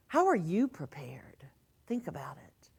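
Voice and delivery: hushed whispered question. Retold or imitated. Minister whispering a quoted post to the congregation. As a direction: whisper it like a secret reflection.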